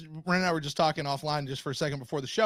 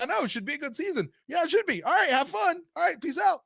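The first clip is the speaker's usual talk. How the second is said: upbeat chat; 11 semitones higher than usual. Retold or imitated. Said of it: "I was like".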